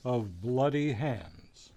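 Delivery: ordinary talk, nothing marked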